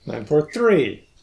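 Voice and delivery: "Nine four three" with "three" stressed and drawn out; emphasis on last word. Shown as a demonstration. Copying the researcher's emphasized digits.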